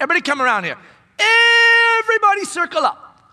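loud, high call to gather; voicing Jesus calling everyone to circle up, loud. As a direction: call the crowd in from across the field.

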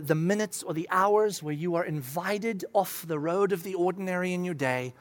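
ordinary talk

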